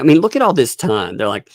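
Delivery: ordinary delivery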